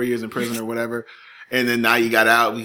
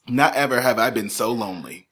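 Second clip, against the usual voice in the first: ordinary delivery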